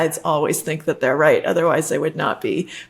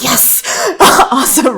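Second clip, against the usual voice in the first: higher, louder, laughing whoop; slow gleeful yes through a laugh; mock elated cheer; 7 semitones higher, 6 dB louder, slower than usual. Host acting out her joy at the poll, laughing cheer. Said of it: "I'm like".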